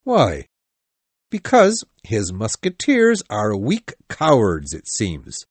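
low, stern captain with stressed words; serious. A narrator's reading. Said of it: "said Treville seriously".

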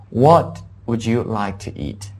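slow, spaced-out English question; deliberate teacher's model for learners; model reading. Shown as a demonstration. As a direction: set the first word apart, then continue carefully. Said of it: "注意一下，我们可以这么".

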